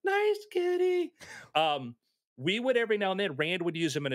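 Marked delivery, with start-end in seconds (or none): 0.0-1.1 high, slow, nervous coaxing voice. Retold or imitated. Man backing away, coaxing the cougar in a high voice. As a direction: coo at the big cat to stay back. Soothing scared cat, high.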